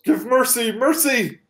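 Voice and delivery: high, loud begging cry; squealing orc pleading for mercy. Acted. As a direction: beg for your life like a beaten orc. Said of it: "And he goes".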